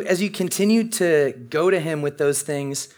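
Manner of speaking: ordinary delivery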